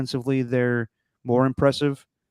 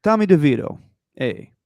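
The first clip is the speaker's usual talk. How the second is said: hammed-up Italian-American accent, higher; 4 semitones higher than usual. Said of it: "So let me do it for this".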